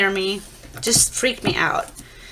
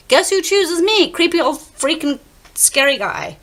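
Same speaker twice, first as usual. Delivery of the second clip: indignant dread; 9 semitones higher, 3 dB louder, slower than usual. Real, not acted. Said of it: "dread disgust build".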